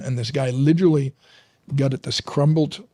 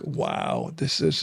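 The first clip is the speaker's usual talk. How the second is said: low, soft, stunned "wow"; awed hush; 9 semitones lower, 5 dB quieter, much slower than usual. Retold or imitated. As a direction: breathe it out in awe. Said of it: "I was just like".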